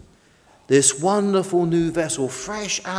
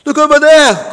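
high, loud call; crying out; 11 semitones higher, 12 dB louder than usual. A narrator's reading. Fish points across the harbour, crying out high. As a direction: call out to make them look. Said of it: "cried Tug".

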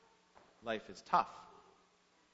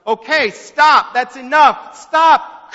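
high, very loud plea; desperate shout; 10 semitones higher, 15 dB louder, slower than usual. A narrator's reading. Tearful boy begging the teacher to stop the blows, shouting. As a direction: shout to halt it, far above your usual pitch. Crying boy halts the beating.

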